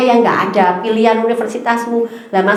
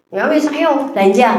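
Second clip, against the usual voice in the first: sister grudgingly allowing; 2 semitones higher than usual. Retold or imitated. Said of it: "adikku tuh kalau ngomel".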